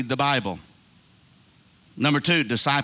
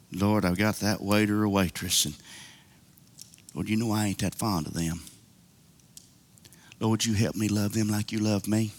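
humble prayer; 6 semitones lower, 4 dB quieter than usual. Shown as a demonstration.

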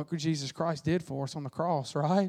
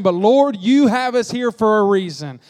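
much louder, crying out to the Lord; fervent loud plea; 3 semitones higher, 8 dB louder than usual. Acted. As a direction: call it out with fervor. Preaching the plea loudly.

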